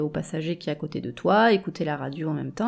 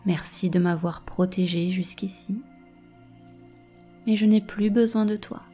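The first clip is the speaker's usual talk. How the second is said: guided meditation. Acted. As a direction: calmly guide each image. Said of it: "Je vais te guider dans une méditation".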